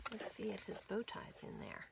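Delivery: quick hushed whisper; fast secret whisper to the kids; whispered aside. Acted. Aside to child listeners.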